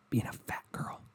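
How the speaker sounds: whispered aside. Real, not acted. Sneaking the rude punchline out in a whisper. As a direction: drop to a whisper for the rude part.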